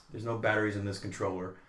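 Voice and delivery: low, soft pouting refusal; imitated sulky child. Daughter refusing to play with dad, imitated low sulk. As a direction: play a toddler quietly refusing.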